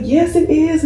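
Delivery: high proud mom voice; imitated mom. Mom showing off her child to the nurse, high proud voice. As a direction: confirm it proudly.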